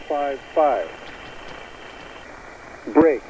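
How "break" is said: ordinary talk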